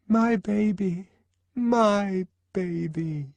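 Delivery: put-on high, slow, crooning my baby; crooning pre-modern mother voice, high and drawn out; doting mother. A narrator's reading. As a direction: coo it over a cradle like a besotted mother.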